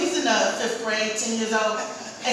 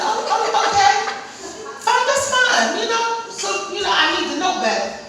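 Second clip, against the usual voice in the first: mock-sweet okay, pitched up; higher sarcastic agreement; 7 semitones higher than usual. Mother to her know-it-all son, high sarcastic agreement. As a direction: humor him with fake agreement.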